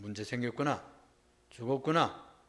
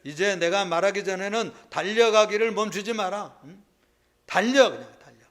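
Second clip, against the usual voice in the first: urgent order; 5 semitones higher, 9 dB louder than usual. Acted. Woman urging servant to run.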